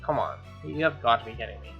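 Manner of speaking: ordinary talk, nothing marked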